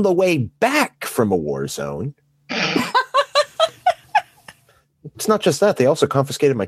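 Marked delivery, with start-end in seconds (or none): 2.5-4.2 high laugh. Real, not acted. Listener reacts to the confiscated nail clippers story: high laughing. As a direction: burst of high-pitched laughter.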